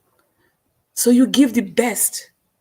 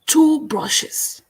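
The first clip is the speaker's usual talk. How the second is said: "Two brushes" said higher and firmer, stress on "Two"; higher emphatic repeat; 6 semitones higher than usual. Acted. Host to viewers, repeating "Two brushes" higher and emphatic. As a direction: ring out "Two brushes" up high. Livestream host's brush metaphor.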